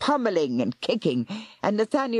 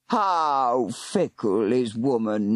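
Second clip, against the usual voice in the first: low, slow sneering growl; 5 semitones lower, slower than usual. Acted. Warlock sneering at the girl, low growl. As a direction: sneer as you close in. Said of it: "Nathaniel growled".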